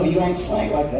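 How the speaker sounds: usual speaking voice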